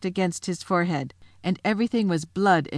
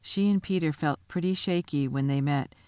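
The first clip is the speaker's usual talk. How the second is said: plain reading voice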